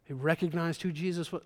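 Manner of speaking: normal talking voice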